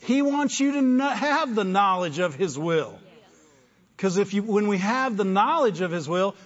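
loud, high fervent preaching; rising, fiery sermon voice; preaching at full force. Acted. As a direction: lift it up and preach it out. Preaching climax.

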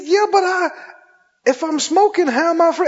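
high, baffled objection; retold puzzlement. Smoker asking preacher how it works, baffled. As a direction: imitate a confused man protesting, pitched well up. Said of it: "He looked at me puzzled".

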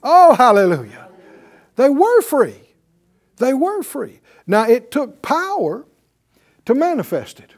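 high, forceful sermon delivery; emphatic preaching. Real, not acted. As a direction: proclaim it to the congregation, drawing out key words.

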